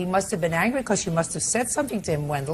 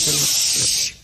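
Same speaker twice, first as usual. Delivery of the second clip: unvoiced hushing sound; sharp shush; 4 semitones lower than usual. Real, not acted. Judge silencing an interrupting witness, sharp shush. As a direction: cut them off with a shush.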